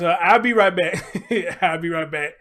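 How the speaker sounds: loud laughing sign-off. Real, not acted. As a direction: laugh through the words. Laughing while signing off.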